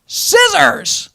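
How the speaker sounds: high loud yell from the water; wife surfacing in the canal, yelling back high and loud. Retold or imitated. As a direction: cry it out as she surfaces. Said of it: "she yells at him".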